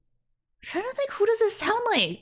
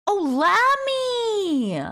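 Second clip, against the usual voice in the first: usual speaking voice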